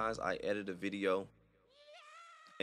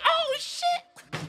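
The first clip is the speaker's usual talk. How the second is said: very high startled yelp; startled shriek; 8 dB louder than usual. Pretend. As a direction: jump out of your skin. Startled reaction in imagined video.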